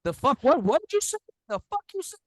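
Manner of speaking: high-pitched cartoon indignation; mock outrage. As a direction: squeal the question like an offended caller. Mock angry caller on phone.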